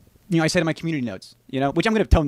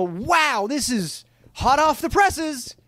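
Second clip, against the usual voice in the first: higher, drawn-out wow; put-on excitement, pitched up; mock amazement; 10 semitones higher, much slower than usual. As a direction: imitate a gullible hot-news reveal. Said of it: "people can just make shit up".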